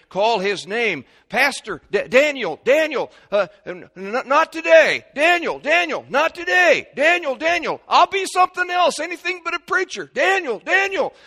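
loud, high back-and-forth shouting; shouted call and refusal. Acted. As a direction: hammer out the calls and stubborn refusals. Acted stubborn man refusing God's call.

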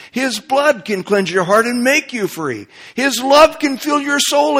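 hymn recited. Shown as a demonstration. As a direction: declaim the verses with rhythm. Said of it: "Listen to this".

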